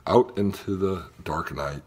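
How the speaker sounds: ordinary delivery, nothing marked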